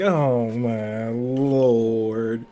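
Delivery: very slow drawn-out "oh my lord"; exasperated groan. Real, not acted. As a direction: drag it out in disbelief. Exasperated at joke.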